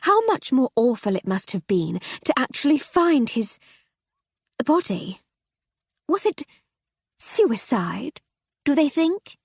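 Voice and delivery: rising high, eager to know; society lady voice. Acted. Mrs Seddon curious.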